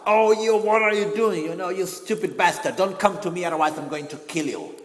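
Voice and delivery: loud, high angry threat; furious mock shout with a stick; shouting at the enemy. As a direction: roar it at the unseen foe. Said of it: "start shouting".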